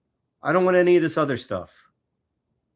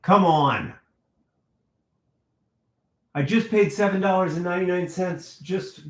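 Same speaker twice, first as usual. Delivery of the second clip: whiny complaint; 5 semitones higher than usual. Real, not acted. Whining about the money paid.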